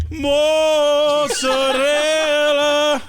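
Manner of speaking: put-on loud, high, hugely stretched Italian accent; operatic Italian mockery. Friend mocking co-host at the counter, sung-out Italian accent. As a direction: belt it out like an Italian tenor. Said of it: "But you were like".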